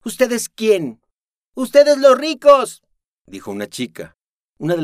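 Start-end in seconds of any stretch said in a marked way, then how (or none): 0.0-1.0 high, loud, stressing ustedes in contempt; disgusted challenge. Acted. Noemí challenging Aris, disgusted. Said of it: "escupió Noemí asqueada". 1.5-2.8 very high, very loud, slow sneer; scornful retort. Acted. The girl sneering at Noemí, scornful.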